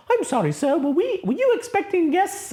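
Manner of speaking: high, fussy protocol droid; a fussy robot butler asking; prim droid voice. Retold or imitated. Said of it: "the droid's like".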